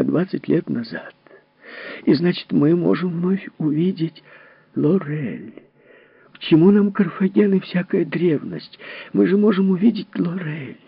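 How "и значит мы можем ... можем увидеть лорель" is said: high, eager, pleading. Acted. Mother begs Foster to leave the machine, eager. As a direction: beg to see the lost daughter.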